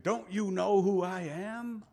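slow, haughty and puffed up; proud attitude. Retold or imitated. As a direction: lean back and demand respect like a big shot. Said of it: "He kinda has that attitude".

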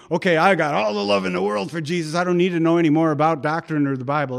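fast, breezy dismissal; complacent believer. Retold or imitated. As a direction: rattle it off carelessly.

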